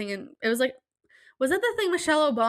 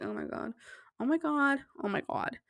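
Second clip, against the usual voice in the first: much softer, sinking dread; despairing mumble; 3 semitones higher, 8 dB quieter than usual. Retold or imitated. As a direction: mumble it to yourself, defeated. Sliding to the ground in despair.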